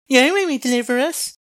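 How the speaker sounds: very high put-on voice; high-pitched mock quote; mocking mimicry of Hezekiah. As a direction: parrot the king's promise in a silly high voice. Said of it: "when he says".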